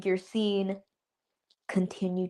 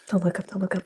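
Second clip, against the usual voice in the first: urgent whisper to oneself; 3 semitones lower, faster than usual. Retold or imitated. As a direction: whisper it under your breath. Self-talk while filming, whispered.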